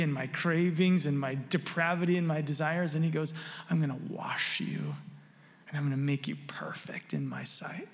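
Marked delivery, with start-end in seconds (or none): 3.7-7.9 whispered promise. Retold or imitated. Pastor voicing Jesus to a dead sinner in a whisper.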